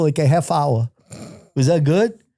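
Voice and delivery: ordinary delivery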